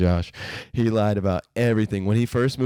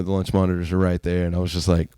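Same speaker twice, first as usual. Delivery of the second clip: ordinary delivery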